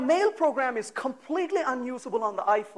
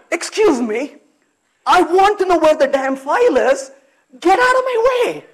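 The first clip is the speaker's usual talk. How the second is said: loud, exasperated telling-off; irritated; 5 semitones higher, 7 dB louder than usual. Retold or imitated. Searching user scolding the animated search dog, loud and fed up. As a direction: snap at the wagging dog to get out of the way. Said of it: "I've been angry".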